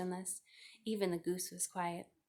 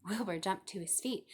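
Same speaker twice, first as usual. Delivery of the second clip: usual speaking voice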